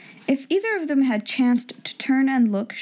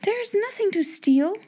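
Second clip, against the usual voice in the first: put-on cheerful girl; 10 semitones higher than usual. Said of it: "Sarah began cheerfully".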